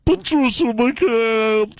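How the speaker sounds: slow, drawn-out ad-lady sign-off; a slow, exaggerated radio sign-off; Patricia McCann impression. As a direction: stretch the name out like a radio ad voice. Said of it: "she got the voice that sounds like this".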